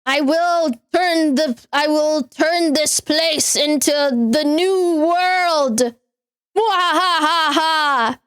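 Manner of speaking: hammed-up villain monologue with evil laugh; mad scientist. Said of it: "Riku's the scientist".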